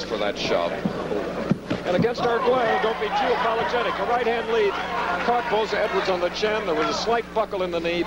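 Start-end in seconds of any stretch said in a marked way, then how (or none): 1.9-8.0 much higher, faster excited commentary. Real, not acted. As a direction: call the punch with sudden excitement. Commentator reacts to landed punch.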